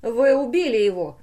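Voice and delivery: loud, horrified exclamation; crying out. A narrator's reading. Pateral sees the dials at zero and cries out. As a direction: cry out in accusation. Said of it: "вскричал он".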